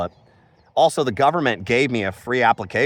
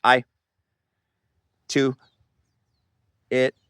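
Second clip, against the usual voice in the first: broken single words with long gaps; halting word-search demo; slower than usual. Shown as a demonstration. Showing the official how he'd sound without words, halting. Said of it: "I would sound like this".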